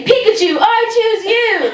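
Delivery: high, slow, drawn-out battle cry; Pokémon trainer call. Pretend. Throwing a ball at shoppers, mock trainer battle cry. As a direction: call it out like an anime hero.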